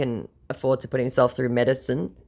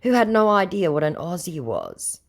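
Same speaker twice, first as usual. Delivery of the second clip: ordinary talk, nothing marked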